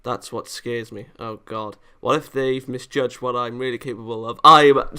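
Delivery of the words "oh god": ordinary talk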